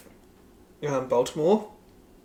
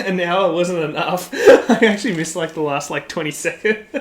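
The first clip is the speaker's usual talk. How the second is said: laughing through the words; amused, loud laugh while talking; 4 semitones higher, 7 dB louder than usual. Real, not acted. Laughing at own mishap.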